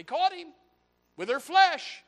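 high, loud shout; preaching shout. Real, not acted. Preacher warning girls about marriage, loud high shout. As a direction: belt out the warning.

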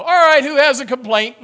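loud, high call for complaints; mock service leader. Preacher pretending to open a gripe time. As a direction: call out like a cheery host.

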